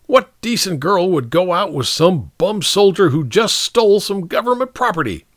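high, indignant officer; scornful captain. Acted. Captain berating a soldier who took government property, scornful. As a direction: dress down a soldier with contempt.

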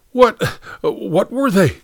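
high, choked-up gasp of a question. A narrator's reading. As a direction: blurt it out, throat tight. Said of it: "choked James Ezekiel Patch".

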